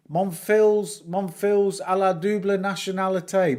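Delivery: put-on Northern English-accented French. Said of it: "I talk French in my Northern English accent".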